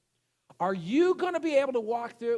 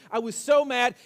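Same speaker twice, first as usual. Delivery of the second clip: ordinary talk, nothing marked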